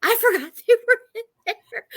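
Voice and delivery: high and fast, laughing through the words; laughing embarrassed. Retold or imitated. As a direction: giggle out the confession. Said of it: "And I was like".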